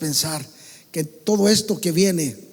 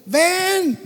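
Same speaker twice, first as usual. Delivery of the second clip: high calling "¡Ven!"; retold shout; 8 semitones higher than usual. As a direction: call "¡Ven!" across the distance. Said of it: "le grité".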